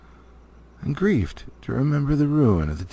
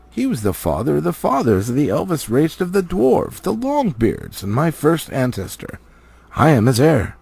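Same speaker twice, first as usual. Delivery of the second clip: lightly voiced proud dwarf, rising to his heritage; a hint of a proud dwarf claiming his line; Thorin, proud dwarf king; 5 semitones higher, 4 dB louder than usual. Thorin tells Elrond he is Durin's heir, proud.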